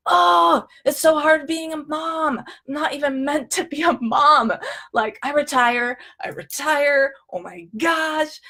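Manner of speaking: mock-overwhelmed lament; crazy dramatic. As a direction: wail it like a melodramatic inner voice. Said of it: "these are crazy dramatic thoughts".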